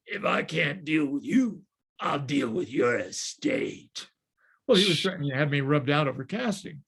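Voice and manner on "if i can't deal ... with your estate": slow, put-on gangster threat; mafioso's voice. Mafioso threatening the producer over casting, slow mock menace. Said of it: "he talks like this".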